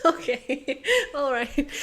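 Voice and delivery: loud laughing words. Real, not acted. Finished the puzzle, laughing loudly.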